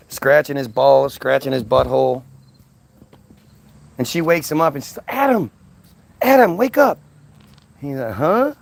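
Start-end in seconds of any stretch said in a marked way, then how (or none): 5.1-8.5 high, pressing calls; mock eager nudging; urgent waking. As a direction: shake him awake, excited. Eve waking Adam urgently.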